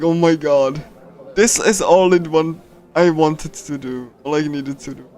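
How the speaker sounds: usual speaking voice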